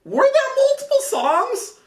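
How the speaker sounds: very high, squeaky mimicking voice; high mock question. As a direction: ham up her question, very high. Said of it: "She asked me".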